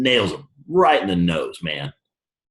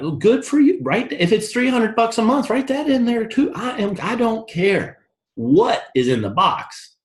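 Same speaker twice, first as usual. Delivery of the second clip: sarcastic mockery; 13 semitones higher than usual. Mocking reply to imagined objector.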